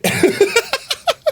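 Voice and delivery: high squeaky giggle; delighted high-pitched giggle at the prank. Real, not acted.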